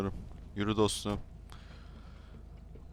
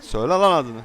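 demanding shout; 3 semitones higher, 8 dB louder than usual. Acted. Demanding caller's name.

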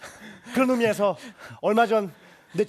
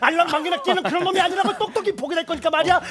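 higher and louder, building with a laugh; worked-up rant; 5 semitones higher, 5 dB louder than usual. Acted. He rants about showing his friend, higher, louder, laughing. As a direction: work yourself into a frenzy.

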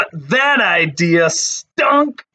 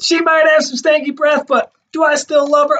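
high, bright teasing question; playful question; 12 semitones higher, 5 dB louder than usual. Real, not acted. Talking to viewers about her dog, high playful question. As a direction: tease them with the question.

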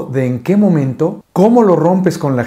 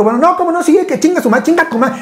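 playful insistence; 12 semitones higher, faster than usual. Retold or imitated. Arguing playfully with wife.

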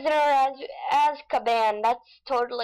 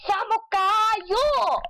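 very slow high drawn exclamation; exclaimed; 8 semitones higher, much slower than usual. Pretend. Shouting Filipino word.

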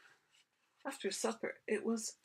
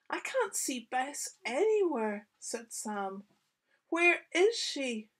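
lightly voiced boy, pitched higher; a touch of worry, higher and louder; worried young Sam; 6 semitones higher, 5 dB louder than usual. A narrator's reading. Boy tells his dad the dog is missing, worried.

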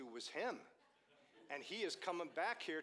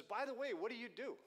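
lightly voiced high-pitched stranger; chatty stranger; 9 semitones higher, 4 dB louder than usual. Stranger to driver while changing a tire, lightly imitated chatty voice. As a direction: toss the question out high, imitating him.

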